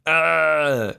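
a little pathetic yelp into the pillow; pathetic whimper scream. Retold or imitated. Lying in bed under the covers, a mock pathetic whimper. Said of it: "Screaming like little pathetic whimpers".

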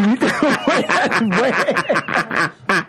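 slow, laughing while catching himself; flustered by his slip, laughing through it. Real, not acted. Flustered after a misspoken line.

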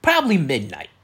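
normal talking voice

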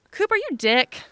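high, loud mock telling-off of a dog; joking scolding. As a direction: imitate a husband jokingly blaming the dog. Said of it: "He just said it jokingly".

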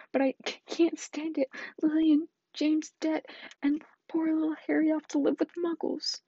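high, loud, tearful wail; sobbing. Acted. Hagrid grieving for Lily and James, loud high sobbing. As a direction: break down over the lost parents. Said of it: "sobbed Hagrid".

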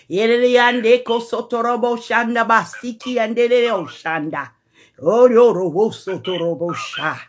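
low murmured tongues prayer; speaking in tongues. Real, not acted. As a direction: sink deep into prayer tongues.

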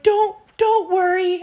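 high, light imitation of a weepy voice; imitated crying reassurance. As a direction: imitate her pleading through tears. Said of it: "she's crying, and she's like".

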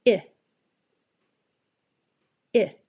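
short relaxed ih "I" slowly repeated; I sound drill. Shown as a demonstration. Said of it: "Listen and repeat".

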